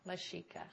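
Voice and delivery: "Mashika" repeated softly as ma-SHEE-ka; Hebrew pronunciation. Shown as a demonstration. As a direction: quietly model "Mashika" for learners. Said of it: "word is pronounced Mashika".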